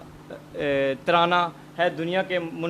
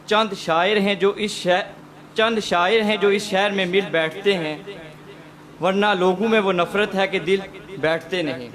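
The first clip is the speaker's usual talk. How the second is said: louder, measured Urdu verse recital; couplet recitation; 2 semitones higher, 3 dB louder than usual. Acted. Host introducing a poet with verse, declaimed couplet. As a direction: declaim the couplet with emphatic pauses.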